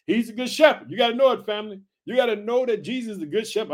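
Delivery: normal talking voice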